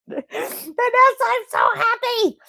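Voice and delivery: high, tearful squeal of joy with laughter; happy crying. Real, not acted. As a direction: wail it happily through tears and laughs. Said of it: "crying made my nose run".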